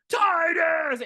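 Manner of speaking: high, loud shout of the name; calling out. Retold or imitated. Granny shouting for her zombie husband, high and loud. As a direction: call him across the room. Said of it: "then she's like".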